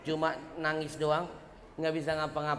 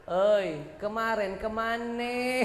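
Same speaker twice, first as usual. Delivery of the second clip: 1 teasing angel; 6 semitones higher, slower than usual. Acted. Voicing the angel mocking.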